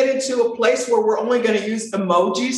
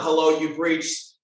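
flat, bored lower voicemail greeting; nonchalant voicemail; 6 semitones lower than usual. Retold or imitated. Mocking a default voicemail, bored and low. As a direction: rattle it off like you couldn't care less. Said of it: "it's just nonchalant".

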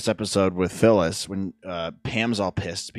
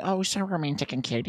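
mock gushing; 8 semitones higher, 7 dB quieter than usual. Imitating his past gushing self.

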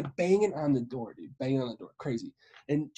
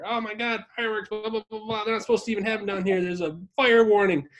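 higher-pitched mock complaining; irritated rant, played for laughs; imitated pissed-off griping; 5 semitones higher, faster than usual. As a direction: grumble in imitation of an annoyed mother. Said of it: "she's pissed".

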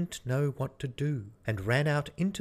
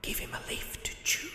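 Death; 3 semitones higher, 3 dB quieter than usual. Acted. Death voiced, long speech.

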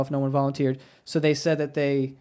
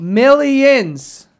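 loud, high, stretched-out word; big emphatic shout; 8 semitones higher, 8 dB louder than usual. Real, not acted. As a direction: belt it out to stress the fortune. Gleeful emphasis on the payout.

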